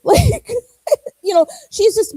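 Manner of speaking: real laugh breaking into a teary voice; laugh, nearly crying, over how grown she is; laughing through tears. Real, not acted. Laughing while talking of daughter.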